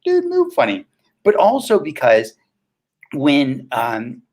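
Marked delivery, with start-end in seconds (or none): 0.1-0.8 high, far-off voice of a confused stranger; mock-bewildered onlooker voice, pitched way up; puzzled onlooker. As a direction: play a baffled viewer staring at him. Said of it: "So you're gonna be like".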